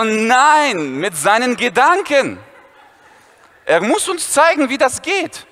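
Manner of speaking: high eager schoolboy; eager Viktor. Retold or imitated. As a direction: press him eagerly. Viktor pleading to Dennis.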